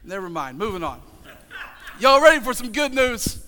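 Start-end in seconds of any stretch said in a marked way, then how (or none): 2.0-3.4 call to the crowd. Real, not acted. Preacher calling out to crowd.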